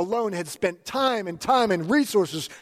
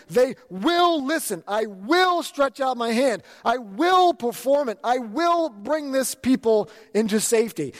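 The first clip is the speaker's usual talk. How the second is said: higher, slow, emphatic preaching of God's promises; 6 semitones higher, slower than usual. Acted. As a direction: proclaim each "I will" like a sermon climax.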